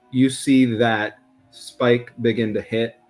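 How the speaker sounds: ordinary talk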